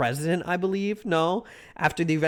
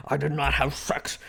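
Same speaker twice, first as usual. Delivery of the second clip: Clinton impression. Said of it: "Clinton impression".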